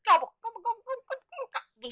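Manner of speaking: imitated turkey gobbles climbing high and fading; turkey gobbling. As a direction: mimic a flock of turkeys gobbling. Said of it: "the weird noises they make".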